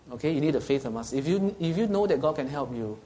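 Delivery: normal talking voice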